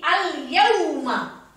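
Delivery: slow "al yauma", full l then a clear long yau. Shown as a demonstration. Teacher showing which letter is clear, slow "al yauma". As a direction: sound each part of "al yauma" slowly. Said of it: "Apanya yang jelas, Umi? Huruf ianya".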